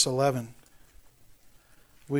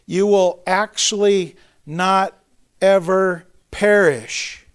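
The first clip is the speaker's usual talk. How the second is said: "not ever perish" said slowly, higher and louder, "ever" hit hardest; emphatic stress; 6 semitones higher, 7 dB louder, slower than usual. Shown as a demonstration. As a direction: drive home "You will actually not ever perish" word by word. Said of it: "it's an emphatic".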